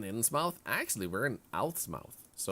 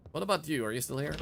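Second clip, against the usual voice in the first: normal talking voice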